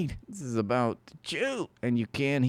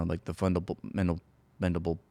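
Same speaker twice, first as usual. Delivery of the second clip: ordinary delivery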